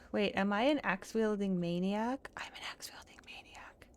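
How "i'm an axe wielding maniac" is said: breathy, voiceless whisper; whispered realisation. Acted. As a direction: whisper it to yourself as it dawns. Hushed realisation, repeats own question.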